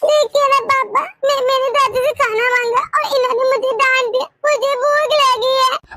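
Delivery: put-on little girl complaining to papa about grandma; child complaining.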